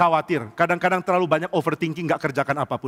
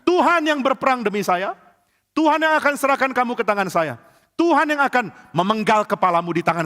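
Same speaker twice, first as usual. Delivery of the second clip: bold shout; 6 semitones higher than usual. Acted. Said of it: "Daud berani teriak seperti itu".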